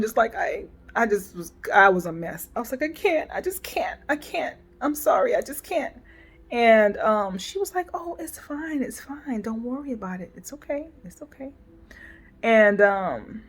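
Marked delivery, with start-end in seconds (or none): 2.8-5.9 high, hushed, breaking "I can't"; tearful whisper. Retold or imitated. To her client, a high tearful whisper. 7.9-11.5 soothing reassurance. Retold or imitated. Cantrell comforting her, retold.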